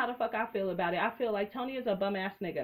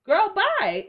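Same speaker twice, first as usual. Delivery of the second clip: sassy loud dismissal; 9 semitones higher, 9 dB louder than usual. Real, not acted. To Tammy on the show, loud sassy brush-off. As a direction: wave her away, big and loud.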